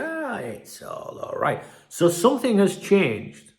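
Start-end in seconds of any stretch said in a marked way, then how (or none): 0.0-1.6 soft, offhand shrug of a line; mock breezy calm. Journalist now playing it down, mock breezy calm. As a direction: brush it off like nothing's wrong. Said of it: "borderline alarmist to".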